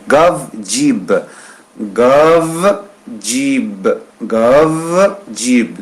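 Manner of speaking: teacher drilling gav and jib; pronunciation drill. Shown as a demonstration. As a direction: repeat gav and jib in turns. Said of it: "je vais prononcer".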